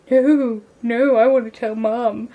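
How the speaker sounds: loud, drawn-out mock tattletale; goody two-shoes protest. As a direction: play yourself as the prim little telltale. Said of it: "I was like".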